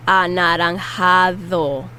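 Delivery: Anaranjado said slowly with a soft th-like D. Shown as a demonstration. Teacher modelling the soft D, slow and clear. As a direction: draw out Anaranjado, soft D like English th. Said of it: "the D is the soft D".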